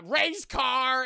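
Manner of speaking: slow, high, loud blurt; struggling robot head. Retold or imitated. Head answers the woman, slow loud blurt. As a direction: drag the words out loudly. Head repeats its only words.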